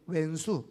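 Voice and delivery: 왼수 said with a wi-like first vowel, not 원수; 왼수 drawn out as a dialect word; Jeolla pronunciation. Shown as a demonstration. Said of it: "원수와 왼수는 달라요".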